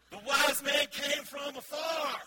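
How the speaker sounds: shouted sing-song. Real, not acted. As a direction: belt the punchline with a chanting lilt. Preacher repeats punchline to crowd.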